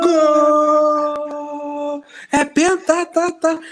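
shouted high goal call; explosive goal commentary. Pretend. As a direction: yell it like a commentator.

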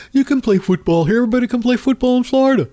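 high, fast, chirpy salesman voice; a mock-cheerful governor rattling off the invitation; mocking DeSantis. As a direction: sell Florida in a breathless high pitch. Mocks DeSantis inviting everyone to Florida.

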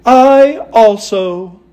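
high, slow, stretched-out Tin Woodman. A narrator's reading. Tin Woodman agreeing with the Scarecrow, high and slow. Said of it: "said the Tin Woodman".

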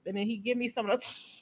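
ordinary delivery

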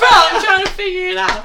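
half-laugh at how absurd it is; fed-up laughing reply; laughing exasperation. Retold or imitated. Server's exasperated reply retold.

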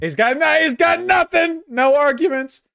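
loud high taunting shout; gleeful shout. Acted. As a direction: crow it out loud at him. Mocking friend's silence, shouting.

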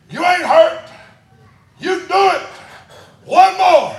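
high, loud coach's urging; coach's shout. Retold or imitated. Coach driving a crying boy on, loud and high. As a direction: holler like a coach pushing for another rep.

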